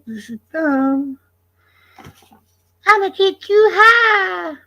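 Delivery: very high, slow put-on voice; squeaky drawn-out stoner cartoon character. Streamer entertaining chat about getting high, slow high-pitched character voice.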